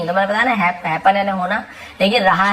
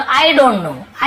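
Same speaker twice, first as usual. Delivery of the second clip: slow demo; 5 semitones higher, 4 dB louder, much slower than usual. Shown as a demonstration. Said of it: "तो आप क्या बोलो?".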